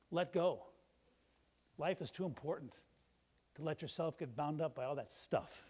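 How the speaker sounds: usual speaking voice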